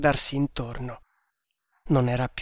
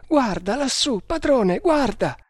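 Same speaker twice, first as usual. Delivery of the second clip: high, frightened exclamation; alarmed urging; 10 semitones higher than usual. A narrator's reading. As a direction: urge the master to look, voice leaping high. Terrified servant points out strange light.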